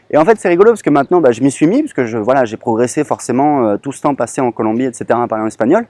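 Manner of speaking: ordinary delivery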